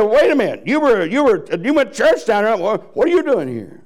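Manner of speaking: stunned to find a churchgoer in hell; shocked damned soul. Acted.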